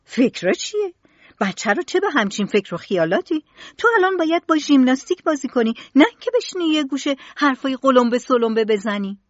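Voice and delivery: loud, high, fast scolding; angry mother. Acted. Mother angrily scolds her son for daydreaming, fast loud high scolding. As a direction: scold the child, losing your temper. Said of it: "با عصبانیت گفت".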